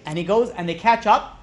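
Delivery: ordinary delivery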